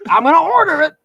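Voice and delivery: imitating a pushy Philadelphia customer; Philly guy impression. Philadelphia man announcing to the crowded shop. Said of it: "he announced everything he was gonna do".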